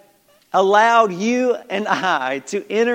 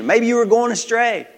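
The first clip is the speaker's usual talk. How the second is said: ordinary talk, nothing marked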